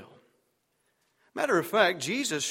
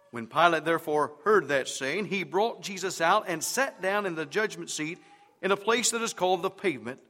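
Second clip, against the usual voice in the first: ordinary talk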